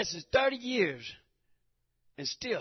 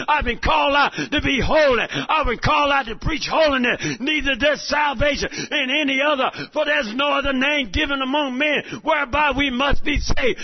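loud, driving sermon cadence; fervent preacher shouting; full-force preaching; 6 semitones higher, 12 dB louder than usual. Acted. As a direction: preach it hard to the congregation.

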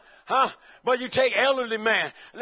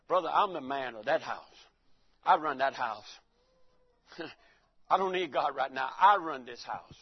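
low man-of-the-house boast; mock-macho, low and self-satisfied; deeper voice; 8 semitones lower, 5 dB quieter than usual. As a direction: drop deep and declare your authority. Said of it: "my voice got more deeper".

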